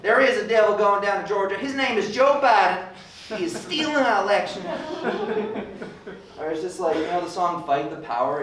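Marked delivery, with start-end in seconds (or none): 0.0-4.6 loud, high mock conservative rant. Mocking a conservative who rewrites the song, shouting it high. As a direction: belt it out like a fired-up conservative singing along. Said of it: "A conservative here's like".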